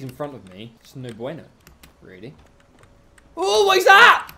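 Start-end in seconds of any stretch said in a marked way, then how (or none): 3.4-4.3 excited. Real, not acted. Excited discovery.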